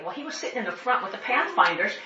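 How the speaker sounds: normal talking voice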